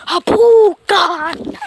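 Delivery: high, loud startled yelp; startled cry. Real, not acted. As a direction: yelp as the cat lashes out. Cat suddenly attacks.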